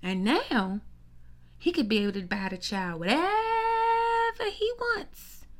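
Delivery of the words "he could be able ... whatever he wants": much higher, slow, outraged; high mocking outrage. Real, not acted. Sister mocking [name]'s rival, high and slow. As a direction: stretch it out high in disbelief. Mock outrage about child.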